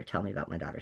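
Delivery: quiet insistence. Retold or imitated. Mother on the phone demanding news of her hurt daughter, quiet and firm. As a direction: press them quietly for news of her child.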